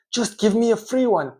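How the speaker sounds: acted loud, high-pitched demand; demanding customer. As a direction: insist loudly as a fed-up customer. Customer's demand as quarrel escalates.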